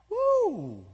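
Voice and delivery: high, bright whoop of delight; excited whoop. Retold or imitated. Believer marvelling at what God did, high excited whoop. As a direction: let a thrilled "woo" fly up.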